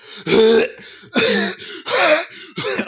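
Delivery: loud, hacking coughing fit. Retold or imitated. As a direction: cough your lungs out, loudly. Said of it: "like, coughing out my lungs".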